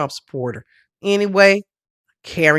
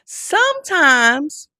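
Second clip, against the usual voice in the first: slow, higher, stretched-out lament; drawn-out slow; 12 semitones higher, slower than usual. Real, not acted. Lamenting funeral makeup, slow and high. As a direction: drag each word out high. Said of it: "speaking slowly and stretched".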